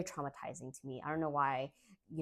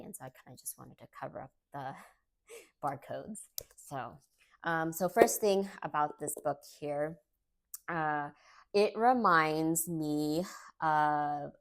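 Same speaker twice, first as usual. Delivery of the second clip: ordinary delivery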